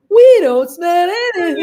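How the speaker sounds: high, slow, sing-song with a laugh; gleeful chorus line. Retold or imitated. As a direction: escalate into a playful, drawn-out chant. Both chant the line, escalating.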